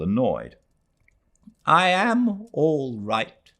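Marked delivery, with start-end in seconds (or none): 1.7-3.5 slow, heavy and weary; a tired old woman's slow, heavy reply; said heavily. A narrator's reading. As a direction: let each word drag with weariness. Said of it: "she said heavily".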